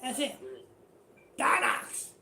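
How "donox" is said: a voiceless, loud rasp of the name; imitating Donox. Preacher mimics how Donox says his own name, voiceless. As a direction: say his name the way the beggar does, no voice. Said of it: "And he talk like this".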